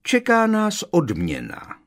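high, slow, loud and delighted; big lout with a childlike high voice; gleeful soft-voiced thug. A narrator's reading. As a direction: gloat slowly in a high voice. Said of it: "rozzářil se Krep. Hlas měl".

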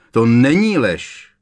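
loud cry of protest; shouting. A narrator's reading. Harry shouts back at the teacher. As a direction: hurl the denial back at her. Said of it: "vykřikl Harry".

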